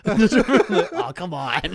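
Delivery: high, loud laughing groan. Real, not acted. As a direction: crack up in disbelief. Laughing at the moldy ceiling.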